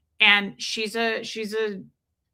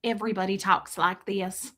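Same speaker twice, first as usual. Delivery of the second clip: a hint of Tennessee southern accent, lower; 3 semitones lower, 4 dB quieter than usual. Shown as a demonstration. Lightly showing off the Tennessee accent around her, Tennessee accent. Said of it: "I live in Tennessee".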